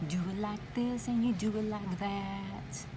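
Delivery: ordinary delivery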